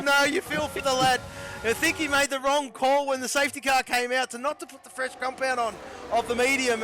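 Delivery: ordinary talk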